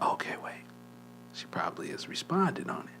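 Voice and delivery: hushed, unvoiced inner thought; whispered hope. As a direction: breathe it as a secret hope.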